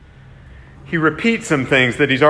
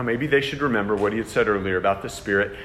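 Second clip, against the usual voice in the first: ordinary delivery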